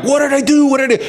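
mild mock scream, pitched high without much volume; retold panicked scream. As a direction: imitate her panicked cry, held back. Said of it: "she's screaming like".

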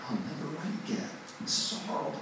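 hushed, hopeless near-whisper; soft, acted self-pity; mock despair. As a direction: mope it very quietly, as if giving up. Acting out his own despair.

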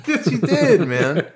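high laughing protest; laughing through it. Real, not acted. To his friend who joked about skipping fifth grade, laughing. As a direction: laugh it out while insisting.

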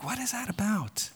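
high, soft bewildered question; mock bafflement in a small high voice; puzzled reaction. Preacher voices the baffled listener, high soft puzzlement.

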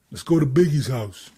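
a hint of the friend's crazy talk; Ernie. Photographer inviting the rapper along, lightly imitated crazy talk. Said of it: "the way he talks is just, like, it's crazy".

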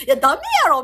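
loud Kansai-style pushback; retorting loudly. Retold or imitated. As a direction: shoot it down loudly. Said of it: "みたいな".

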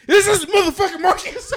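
high and loud through laughter; outraged realization. Retold or imitated. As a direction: realize the prank mid-shout. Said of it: "He goes".

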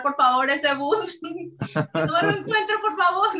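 high, pleading, half laughing; mock pleading. Pleading not to meet that bus.

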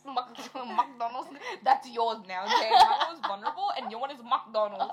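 teasing through laughter; laughing. Real, not acted.

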